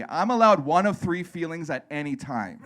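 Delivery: ordinary talk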